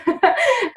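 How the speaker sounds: high-pitched real laugh; brief burst of laughter, up high. Real, not acted. Speaker laughing at her price tag joke, amused laugh.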